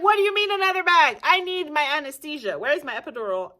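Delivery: high, loud outraged disbelief. Retold or imitated. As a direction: shriek at the nurse in disbelief, then calm slightly. Yelling at the nurse.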